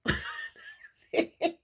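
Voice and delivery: put-on breathy high giggle of a naughty kid; mock childish laugh; cheeky laugh. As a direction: giggle it off like you got away with it. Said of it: "And I just went".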